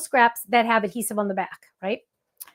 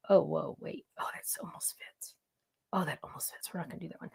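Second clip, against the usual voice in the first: whisper; 8 semitones lower, 14 dB quieter than usual. Real, not acted. Trying stamp fit, muttering alone.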